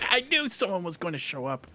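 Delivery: high-pitched, just after a startled laugh; flustered excitement. Real, not acted. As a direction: exclaim it high, rattled by an ambush. Laughing after being ambushed.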